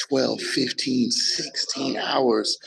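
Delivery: slowly stretched hour counts; drawn-out numbers. Real, not acted. Telling a friend his long workdays, slow and stretched. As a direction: drag each number out for weight.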